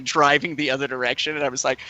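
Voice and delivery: ordinary talk, nothing marked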